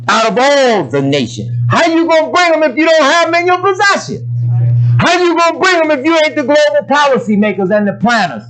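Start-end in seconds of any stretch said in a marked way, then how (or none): 1.7-8.4 high, pressing rhetorical question; rising preacher's challenge; preaching challenge. Real, not acted. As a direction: push the question up hard at the congregation.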